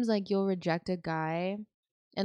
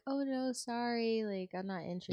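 soft, apologetic let-down; gentle polite rejection; 3 semitones higher, 8 dB quieter than usual. Acted. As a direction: turn someone down quietly.

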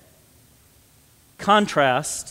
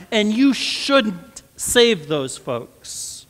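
louder, higher impassioned preaching, slowing on "these are people I love"; preaching at full force; 6 semitones higher, 6 dB louder than usual. Acted. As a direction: drive the point home to the congregation. Climax of the sermon.

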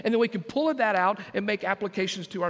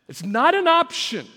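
high, loud declaration; preacher ruling out any option; emphatic shout; 7 semitones higher, 7 dB louder than usual. Real, not acted. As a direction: drive it home with full force.

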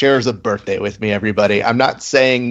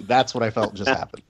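ordinary talk, nothing marked

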